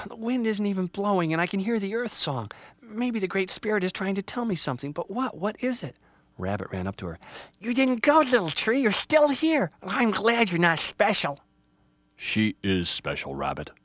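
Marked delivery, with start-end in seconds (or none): 0.1-6.0 high, wondering little voice; puzzled Little Tree. Acted. Little Tree wonders at earth song. 7.6-11.4 high, loud little animal voice; overjoyed Rabbit. Acted. Rabbit rejoices. 12.2-13.7 low, slow grandfatherly voice; booming old tree. Acted. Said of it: "Grandfather Ponderosa's voice boomed".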